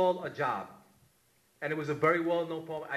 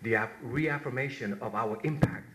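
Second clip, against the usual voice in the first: poem recitation; 5 semitones lower than usual. Acted. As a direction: read it like a eulogy for a fallen brother.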